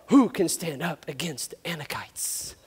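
low, slow, drawn-out question; ominous saying. Acted. Moses quoting the saying about giants, low and ominous.

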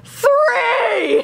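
very high, drawn-out mock scream; stretched high retold yell; calling over noise. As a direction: imitate screaming a number over music. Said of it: "She tries to spell it out".

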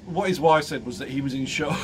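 ordinary talk, nothing marked

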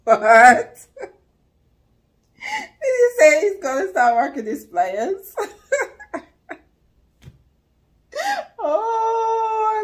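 laughing disbelief that keeps breaking up the words; can barely get the words out for laughing. Real, not acted. The reactor cracks up over the pliers line.

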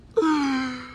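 high half-sobbing laugh; whimpering nervous giggle; nervous crying laugh. Real, not acted. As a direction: laugh through the fright. Nervous reaction in the car wash.

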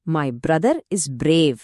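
brother and brave with a smooth br, very slow; slow model sentence. Shown as a demonstration. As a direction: spell it out slowly for learners. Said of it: "brother, Brave". (నెమ్మదిగా స్పష్టంగా చదివిన ఉదాహరణ)

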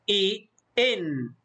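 إي، إن slowly, the second closed on n; "i", then "in" with a lingering nun; vowel, then tanween. Shown as a demonstration. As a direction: demonstrate إي turning into إن, slowly. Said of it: "نضيف لها نون ساكنة خفيفة فنقول".

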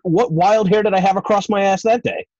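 high, loud, mock-baffled questioning; mock incredulous. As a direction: play up disbelief at your own past self. Host mock-quizzing himself about tryout.

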